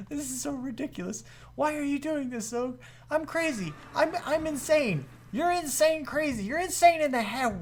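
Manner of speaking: high-pitched, frantic disbelief; exasperated self-scolding. Real, not acted. Gamer asking himself why he's doing this, exasperated. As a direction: berate yourself in a high voice.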